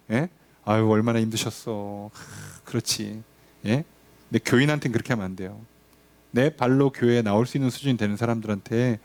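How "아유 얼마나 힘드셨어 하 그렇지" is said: slow, soft pastoral sigh of pity; gentle sympathy. Retold or imitated. Pastor imitating soft comfort for the truly sick, slow and hushed. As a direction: soothe a hurting patient tenderly. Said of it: "그렇게 얘기 안 해요".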